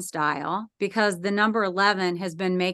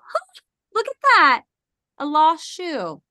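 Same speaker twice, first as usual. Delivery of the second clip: higher, casual husband noticing a shoe; husband's voice; 5 semitones higher, 4 dB louder than usual. Retold or imitated. Husband on the steps spotting a shoe, in a casual higher voice. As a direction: toss it off like a surprised husband. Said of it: "I hear him go".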